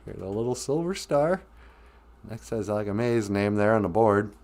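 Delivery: ordinary talk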